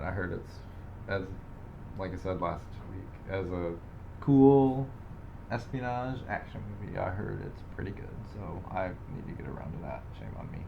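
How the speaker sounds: ordinary talk, nothing marked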